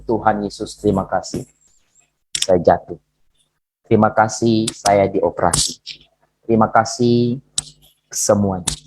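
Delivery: low-pitched retold prayer of thanks; humble prayer. Preacher thanking Jesus for fall and operation, humbly. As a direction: drop the voice and give thanks simply.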